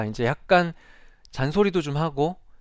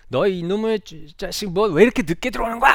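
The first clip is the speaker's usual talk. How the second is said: acted-out angry father, pitched high; imitated angry scolding; 8 semitones higher, 5 dB louder than usual. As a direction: scold a late child in a raised, higher voice. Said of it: "소리를 지르면서 혼을 막 낸". (화난 아버지가 소리치며 혼내는 흉내)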